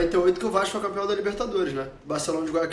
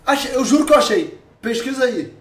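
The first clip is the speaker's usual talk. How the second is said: thrilled, loud and high; excited shout; 6 semitones higher, 8 dB louder than usual. Real, not acted. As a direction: yell it out like you just found it. Found the girl's photo.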